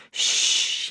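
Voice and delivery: whispered shh; hushing shh. A narrator's reading. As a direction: silence the family. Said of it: "she said".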